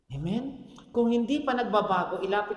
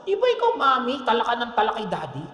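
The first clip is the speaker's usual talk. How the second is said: high, mock child talking back; cheeky child; 4 semitones higher, 7 dB louder than usual. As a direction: pipe up like a kid sassing mom. Child talks back to mom.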